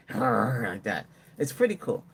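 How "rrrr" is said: a quieter rolling growl, done again more lightly; softer dog growl. Retold or imitated. Owner repeats the robot dog's growl, a softer rolling growl. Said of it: "it goes like that".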